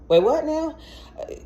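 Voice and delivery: high, baffled "wait, what now?"; stunned disbelief. Retold or imitated. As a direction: squeak out your shock. Shocked again after hangup.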